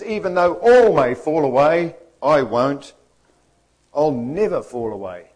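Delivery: loud, bold declaration; full-on Peter. Acted. Peter vows to Jesus he won't fall away, full-on and loud. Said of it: "full on Peter".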